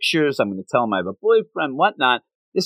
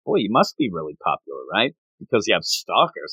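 lower mock guy voice; 6 semitones lower than usual. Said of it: "he says".

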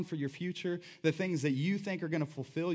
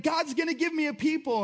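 much higher, excited declaration; joyful promise; 11 semitones higher, 3 dB louder than usual. Retold or imitated. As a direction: announce it with delighted faith. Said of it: "It's like".

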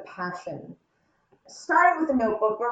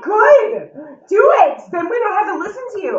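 louder, higher, dismissive retort; snapping back; 6 semitones higher, 4 dB louder than usual. Retold or imitated. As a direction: tell him off with a raised voice. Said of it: "I'm like".

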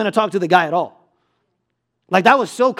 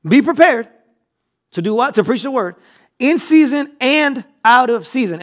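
preaching at full force; 6 semitones higher, 4 dB louder than usual. Acted. Preacher repeats verse.

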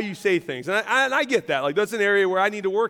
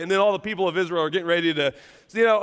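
ordinary talk, nothing marked

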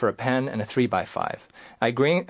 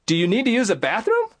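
anxious; 10 semitones higher, 3 dB louder than usual. Retold or imitated. Patient stumbling.

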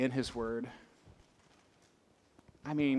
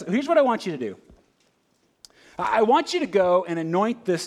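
God giving orders; 5 semitones higher, 6 dB louder than usual. Retold or imitated. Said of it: "he goes".

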